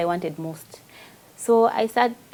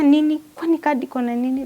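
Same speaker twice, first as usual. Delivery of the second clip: daughter alarmed; 6 semitones higher, 4 dB louder than usual. Retold or imitated. Daughter questioning card.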